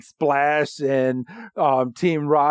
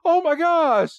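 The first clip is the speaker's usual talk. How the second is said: much higher, panicky assistants' cry; mock alarm; 13 semitones higher than usual. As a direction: imitate flustered assistants fussing over their boss. Voices alarmed assistants to director.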